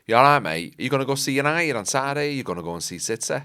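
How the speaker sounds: casual Mancunian lad; Manchester accent. Shown as a demonstration. Asking a mate about football, Manchester accent. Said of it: "a really good Manchester accent".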